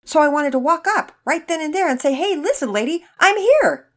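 high, loud, hammed-up declaration; calling out. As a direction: shout it to get the lady's attention. Dad dramatizes wanting to call out.

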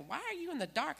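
curious neighbor, voiced high. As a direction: ask it high and surprised, as the neighbor. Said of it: "she said".

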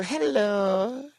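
high rising smiling mock Chinese hello. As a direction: greet like a smiling street seller. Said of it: "a smiling for you".

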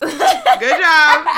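loud laughing praise. Real, not acted. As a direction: laugh while saying it.

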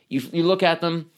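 ordinary talk, nothing marked

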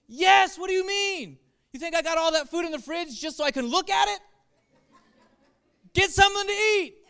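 hammed-up dad answering a child about food; exasperated dad. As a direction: scold the kid fondly for even asking.

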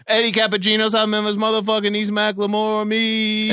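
high, sustained announcer chant; hype announcer. Acted. Host introducing the co-host, hyped announcer chant. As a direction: hold one high note and stretch the ending.

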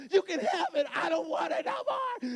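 very high mock child's shout; defiant daughter. Daughter gives up blankie to dad, imitated angry shout.

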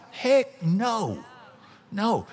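high, punchy refusal; emphatic heck no. Real, not acted. Preacher refusing to be satisfied, emphatic and high. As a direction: throw it back hard.

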